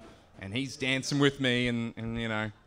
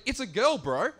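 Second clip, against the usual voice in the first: higher, amused matter-of-fact mate; 7 semitones higher, 3 dB louder than usual. Retold or imitated. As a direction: point out the obvious, laughing. Mate stating the obvious.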